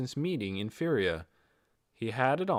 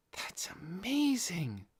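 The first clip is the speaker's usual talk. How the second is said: breathless whisper, slow and awed; soft awed whisper; whispered admiration; 8 semitones higher, 4 dB quieter, much slower than usual. A narrator's reading. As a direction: hush it out like a secret marvel.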